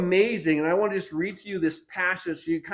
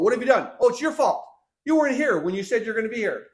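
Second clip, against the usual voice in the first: high, raised-voice blame; mock accusing; 5 semitones higher, 5 dB louder, faster than usual. Preacher acts out Saul blaming Samuel, mock accusing. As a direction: act out an indignant, quick-fire accusation.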